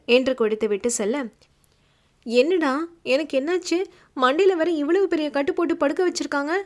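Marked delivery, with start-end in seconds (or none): 2.3-6.5 higher and fast, anxious questions; a touch of panic; worried patient asking what happened; fearfully. Acted. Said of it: "ஒருவித பயத்துடன் கேட்க".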